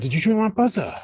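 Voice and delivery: retelling the neighbour's aggressive challenge. Retold or imitated. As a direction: imitate a hostile man confronting you. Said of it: "he was very, very aggressive".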